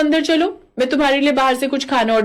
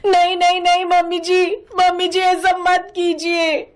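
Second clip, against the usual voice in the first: sleep-talking in panic; 7 semitones higher than usual. Acted. Daughter-in-law crying out to her mother-in-law in a nightmare, panicked pleading. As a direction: beg out of a bad dream. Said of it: "जोर जोर से बड़बड़ाने लगती है".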